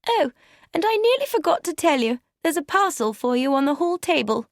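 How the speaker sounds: high-pitched, casual wifely reminder; wife's voice. A narrator's reading. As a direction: play the wife suddenly remembering, pitched high. Wife remembers a parcel.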